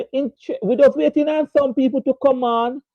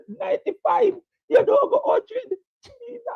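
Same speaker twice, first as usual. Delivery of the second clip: incredulous laugh. Real, not acted. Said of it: "I don't know why I'm laughing tonight".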